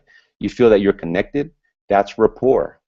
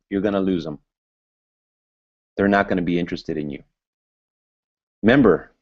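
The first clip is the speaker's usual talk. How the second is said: ordinary delivery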